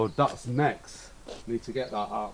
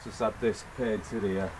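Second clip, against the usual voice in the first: normal talking voice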